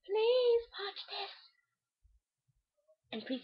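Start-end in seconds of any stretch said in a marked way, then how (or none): 0.0-1.3 mock pleading. Real, not acted. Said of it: "begging viewers".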